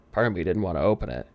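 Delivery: usual speaking voice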